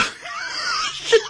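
high breathless laughing fit; helpless laughter. Real, not acted. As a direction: laugh until you can't stop. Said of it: "I can't stop laughing".

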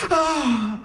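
high startled "oh"; waking gasp. Acted. Girlfriend wakes up suddenly, high acted gasp. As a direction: gasp as if jolted out of sleep. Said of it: "she wakes up and she goes".

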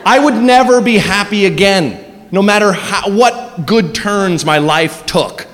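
ordinary talk, nothing marked

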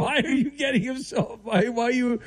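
high-pitched, laughing plea. Real, not acted. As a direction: stammer the question through laughter. Host amused by caller's outburst.

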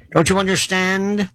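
ordinary delivery

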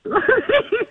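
rising high-pitched giggle. Real, not acted. Laughs before continuing.

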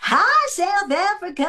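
high, drawn-out country star greeting. Shown as a demonstration. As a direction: call out a big, slow, high-pitched hello. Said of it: "Say hi to".

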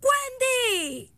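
high, falling long-distance call, mock yell; grito de mamá. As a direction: call the kid home from the porch. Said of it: "Desde el porche te gritaba". (llamado agudo de mamá, sin gritar fuerte)

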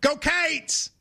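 "Go Cates!" drawn out with the extra syllable; Kentucky cheer. Shown as a demonstration. Host demonstrates the Kentucky cheer for his co-host. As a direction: cheer it like a Kentucky Wildcats fan. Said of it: "in Kentucky, we go".

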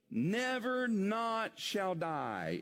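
slow, weighty stress on "never not"; emphatic. Acted. Preacher quoting Jesus's promise, emphatic. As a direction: draw out "never" and "not" as separate strong beats. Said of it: "In other words, it's emphatic".

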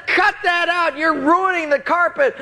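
high, frantic acted scolding; outraged yelling. Student to friend flooding his room, outraged yelling. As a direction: holler in high-pitched indignation.

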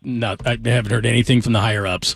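usual speaking voice

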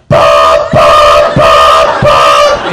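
loud, low, monotone alarm beeps; four even electronic-style honks; alarm clock beeping. Acted. Dream fiancée becomes alarm clock beeping.

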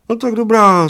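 put-on very high little old man; agreeing little old man. A narrator's reading. Little old man accepting the giant's dare, high-voiced. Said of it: "souhlasil nečekaně maličký".

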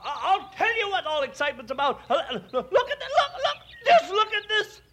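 fast, stammering agitation; worked up. Acted. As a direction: trip over the words in a rush to show it. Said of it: "why get so excited".

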